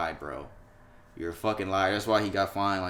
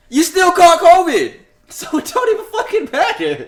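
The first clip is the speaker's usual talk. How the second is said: mocking outburst; 10 dB louder than usual. Real, not acted. Taunting Aaron Rodgers, loud high laughing. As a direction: crow it at him, laughing.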